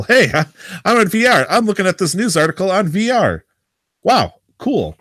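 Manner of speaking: high, hammed-up gushing; put-on wide-eyed enthusiasm; mock excitement. Mock excitement about a VR article.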